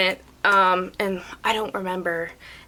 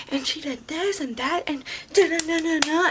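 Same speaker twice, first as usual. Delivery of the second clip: high sing-song mock complaint; mimicked ranting; 7 semitones higher, 4 dB quieter than usual. Customer ranting to the manager, high mocking imitation. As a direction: parody her tattling in a high voice. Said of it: "like, ranting to my manager".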